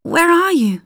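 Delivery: high, frightened call of a name; scared cry for her cousin; fearful call. A narrator's reading. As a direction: call out lost in the dark. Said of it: "she called fearfully".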